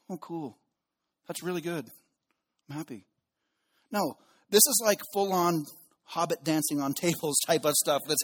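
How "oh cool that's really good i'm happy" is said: softer, deadpan flat-ish cheer; mock underwhelmed. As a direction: play it like it's no big deal.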